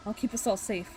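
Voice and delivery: ordinary delivery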